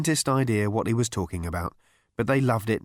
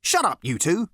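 higher, strident interruption; 8 semitones higher than usual. A narrator's reading. Girl silencing two squabbling men, strident. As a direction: cut in stridently. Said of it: "A girl with a strident voice".

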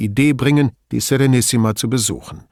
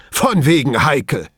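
sarcastic retort, pitched up; 4 semitones higher, 5 dB louder than usual. A narrator's reading. Boss retorting to Brunetti's word heikel, sarcastic. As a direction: throw it back with scorn. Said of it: "gab Pater sarkastisch zurück".

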